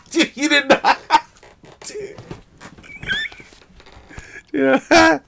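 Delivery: high squeal through laughter; laughing squeal. Real, not acted. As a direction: cracks up, voice jumping way up. Cracking up at chat.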